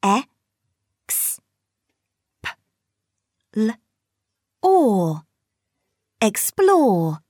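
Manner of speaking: "X. P. L. Or." said as separate sounds, then blended into "Explore"; sounding out words. Shown as a demonstration. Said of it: "Blend the sounds".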